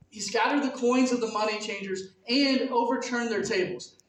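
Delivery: usual speaking voice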